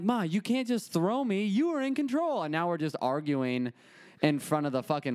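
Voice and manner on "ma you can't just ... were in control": high-pitched, pleading whine at mom; whiny protest. Retold or imitated. Son protesting to his mom on the phone, high whine. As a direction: complain up high like a wronged kid. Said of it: "and I'm like".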